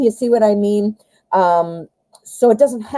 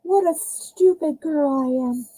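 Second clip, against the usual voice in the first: mock self-scold; 7 semitones higher, 4 dB quieter than usual. Real, not acted. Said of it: "Little Miss girl".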